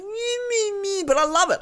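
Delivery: high mock-crying whine; fake-sob the "me, me"; whiny "me, me". Parodying a self-absorbed contestant, high mock whine. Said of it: "sound very much like".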